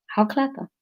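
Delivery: ordinary delivery, nothing marked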